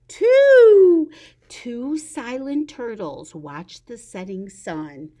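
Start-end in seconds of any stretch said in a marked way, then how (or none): 0.1-1.1 loud high call of two; counting aloud. Acted. Teacher counting turtles for kids, loud high call. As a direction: sing out the number.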